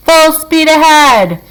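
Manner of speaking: high, loud and slow rallying call; excited cry. A narrator's reading. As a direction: bellow the order with glee. Wonka waving stick, urging rowers.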